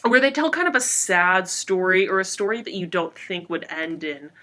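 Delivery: ordinary talk, nothing marked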